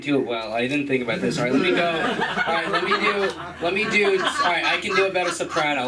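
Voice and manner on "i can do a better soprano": usual speaking voice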